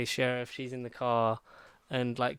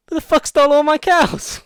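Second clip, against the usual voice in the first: very high, very loud mock yell; outraged yell; 17 dB louder than usual. Farmer finding his cows gone, outraged high yell. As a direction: bellow it up at the sky, outraged. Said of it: "looks up and goes".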